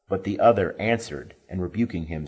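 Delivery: ordinary delivery, nothing marked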